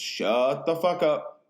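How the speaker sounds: slow, high, drawn-out mock shout; imitated full yell. Justin yelling at chatting friends early morning, full mock shout. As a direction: holler it out, stretching the words. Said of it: "you just really start to hear it".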